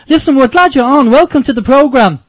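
ordinary delivery

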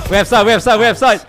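shouted urgent callout; loud callout. Real, not acted. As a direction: call it loud to the team. Loud callout mid-fight.

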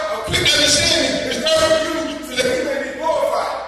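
loud, rising preacher's cry; preaching at full force. Acted. Preacher urging the church to receive quick understanding, loud and fervent. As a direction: drive it home to the congregation, full voice.

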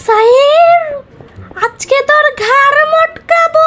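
high, slow ghostly threat; spooky ghost voice. Acted. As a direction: stretch each word like a ghost.

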